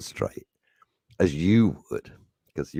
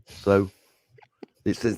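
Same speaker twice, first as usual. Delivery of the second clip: ordinary talk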